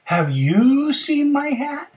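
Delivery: high, slow, loud question; bear asking. Acted. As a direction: stretch it out high, rising at the end. Bear asks the frog.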